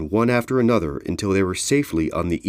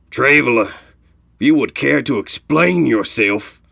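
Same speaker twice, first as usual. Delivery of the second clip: raised, higher voice demanding an answer; calling up sternly; 5 semitones higher, 5 dB louder than usual. Acted. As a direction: hail the traveler and demand an explanation. Leader calls up to flying traveler.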